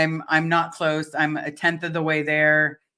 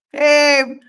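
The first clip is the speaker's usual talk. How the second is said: laughing; 10 semitones higher, 7 dB louder than usual. Real, not acted. Reads funny comment, laughs.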